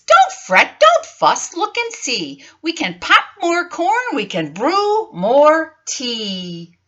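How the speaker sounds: high squeaky comforting mouse. Acted. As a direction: squeak it out to cheer up a friend. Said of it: "Mouse squeaks".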